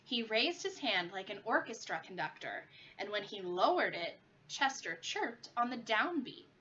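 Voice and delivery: usual speaking voice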